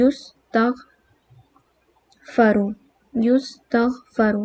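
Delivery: یستغفر very slowly as yustaghfaru, u first then a; یستغفر drawn out, yus-tagh-fa-ru; passive form. Shown as a demonstration. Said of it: "پہلے letter پہ او آئے گا".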